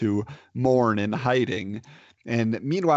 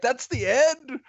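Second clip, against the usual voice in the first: high-pitched, indignant disbelief; incredulous outburst; 12 semitones higher than usual. Retold or imitated. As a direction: retell a cheated viewer's protest, pitched way up. Retells his reaction to the abrupt ending.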